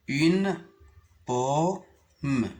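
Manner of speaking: u-ne pomme, en détachant « Une »; sépare « Une » de « pomme »; découpé en syllabes. Shown as a demonstration. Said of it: "Je découpe les mots en syllabes". (syllabes détachées)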